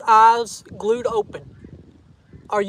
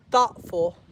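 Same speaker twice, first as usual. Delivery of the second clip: usual speaking voice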